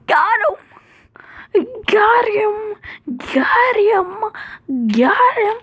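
high, loud, repeated gloating; triumphant cheer. Real, not acted. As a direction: crow it over and over. Triumphant chant.

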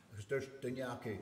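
ordinary delivery

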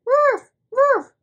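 high, loud puppy woofs; squeaky loud pretend barking; doggy sound. Shown as a demonstration. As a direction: bark like a little dog for a child. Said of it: "What does a doggy sound like?".

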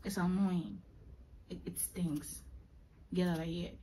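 half-whispered, running out of energy; tired, drained murmur. Real, not acted. Creator tells viewers she is exhausted, soft weary whisper. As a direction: whisper it out, worn out and fed up. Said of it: "I'm getting tired".

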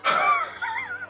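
very high, squeaky laugh; real laugh bursting back out; leftover laughter. Real, not acted. Viewer still overcome by the mistake, laughing.

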